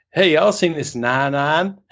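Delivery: strong Southern drawl on nine iron; slow, put-on American Southern accent. Golfer chats to Greg in the shop, Southern accent. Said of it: "really strong, I guess, Southern accent".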